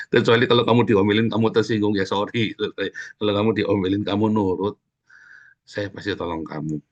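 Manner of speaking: ordinary talk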